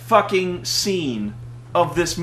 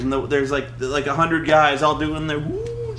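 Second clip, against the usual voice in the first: ordinary delivery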